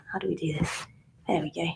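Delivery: muttering to oneself. Real, not acted. Host to self while fixing the setup, muttering. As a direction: think aloud under your breath. Fiddling with setup, talking to herself.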